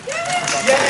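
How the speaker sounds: cheering yay. Real, not acted. Cheering a student's name.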